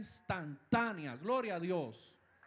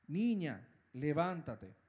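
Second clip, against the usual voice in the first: a firm, raised command to rise; said aloud; 6 semitones lower, slower than usual. A narrator's reading. Jesus to the dead girl, a firm spoken command. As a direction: call the girl up with authority. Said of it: "dijo en voz alta".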